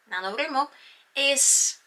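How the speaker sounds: normal talking voice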